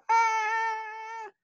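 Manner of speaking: very high, strained wordless cry; scream out. Shown as a demonstration. People who can't pray screaming to God, piercing cry. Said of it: "We can scream out".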